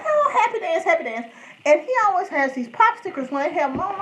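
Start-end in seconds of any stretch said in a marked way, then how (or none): 0.0-1.3 squealing the happy dance up high; imitating a gleeful TV cook; mock food host. Mimicking the QVC food guy's high happy chant. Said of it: "So he's like".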